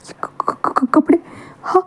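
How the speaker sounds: breathless stutter in shock; a whispered stutter rising in shock; shocked stammer. Acted. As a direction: stumble over the word in disbelief. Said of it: "ऐसे कहते wing बस उतना shock था".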